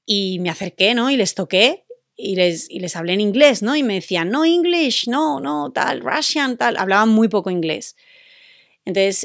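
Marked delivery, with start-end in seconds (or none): 4.3-6.8 higher, quick broken-English protest; mildly voiced Russian tourist waving off English; flustered foreigner. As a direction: play a lost foreigner who can't speak English. Blind Russians answering her English.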